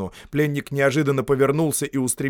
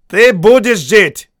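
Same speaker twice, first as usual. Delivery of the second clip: раздражённо бросает, громко; бросает пленнику с досадой; 10 semitones higher, 13 dB louder, slower than usual. A narrator's reading. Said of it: "бросил раздражённый офицер". (раздражённый офицер)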